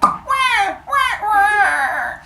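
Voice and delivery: high wailing wah wah; mock baby cry. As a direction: cry like a newborn. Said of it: "little baby Stabby's like".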